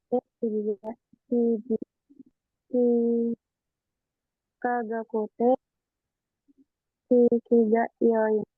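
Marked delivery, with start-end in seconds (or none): none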